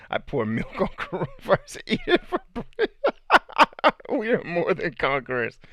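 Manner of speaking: high-pitched, helpless real laughter through the words; laughing while reading. Real, not acted. Streamer reads a viewer's absurd coronavirus breakfast comment, laughing through it. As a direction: crack up as you read it out.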